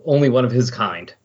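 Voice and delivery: ordinary talk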